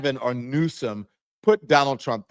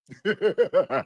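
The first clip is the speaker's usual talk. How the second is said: laugh; 8 semitones higher than usual. Real, not acted. Laughing after reading tweet.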